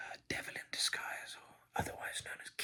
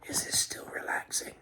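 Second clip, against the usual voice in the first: louder, crackling whisper; crackly whisper; 8 dB louder than usual. Shown as a demonstration. ASMR host testing the listener's calm, crackly whisper. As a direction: push the whisper till it crackles. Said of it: "my voice is starting to crackle".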